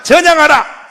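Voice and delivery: loud chant for the crowd to repeat; call-and-response shout. Shown as a demonstration. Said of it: "따라서 합니다". (청중이 따라 외치도록 선창)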